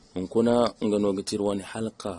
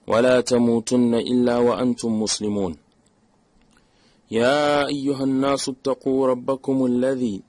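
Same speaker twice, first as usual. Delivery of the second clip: brisk, raised Arabic recitation, easing as it goes on; formal sermon opening; 2 semitones higher, 4 dB louder, faster than usual. Acted. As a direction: recite the opening praise in a firm, measured chant.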